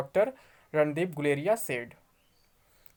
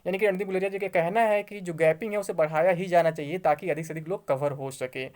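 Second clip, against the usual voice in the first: usual speaking voice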